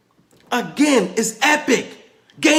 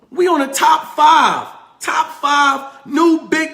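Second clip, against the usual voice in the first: excited shout; 6 semitones higher, 4 dB louder, slower than usual. Real, not acted. Hyping crypto ranking.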